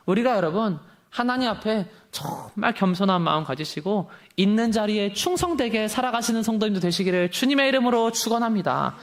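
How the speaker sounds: normal talking voice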